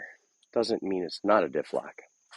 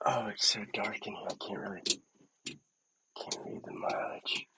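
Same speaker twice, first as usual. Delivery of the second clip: whispering; 11 dB quieter than usual. Real, not acted. Dark cab, reading mileage.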